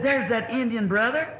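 high, delighted hello; mock-surprised hello to an old friend; excited greeting. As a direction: call out a glad greeting. Greeting the Indian brother.

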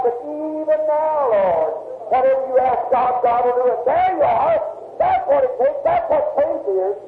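rising revival appeal, stretching the words; fervent preaching. Acted. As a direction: build the plea to a ringing climax. Climax of faith appeal.

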